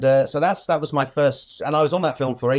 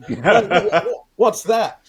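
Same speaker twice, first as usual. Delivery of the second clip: surprised; 8 semitones higher, 5 dB louder, much slower than usual. Retold or imitated. Curious surprised retold question.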